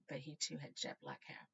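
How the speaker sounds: ordinary delivery, nothing marked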